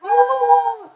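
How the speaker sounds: high, loud drawn-out "oh"; big high-pitched reaction; loud excited gasp. Real, not acted. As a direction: burst out with a high oh.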